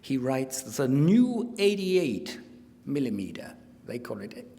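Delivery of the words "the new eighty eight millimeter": put-on emphatic German-accented diary reading; mock German accent. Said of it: "speaking with a mock German accent".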